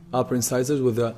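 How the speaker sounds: ordinary talk, nothing marked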